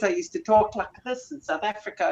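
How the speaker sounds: put-on showing off his old thick South African accent. Shown as a demonstration. Guest demonstrates how he talked as a boy in South Africa, thick South African accent. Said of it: "at a really thick accent".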